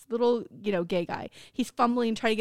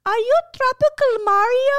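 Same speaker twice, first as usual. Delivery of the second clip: put-on high, loud cute broken English accent; 12 semitones higher, 7 dB louder than usual. Girl asking doorman about costume, cute broken English accent. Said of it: "broken English accent".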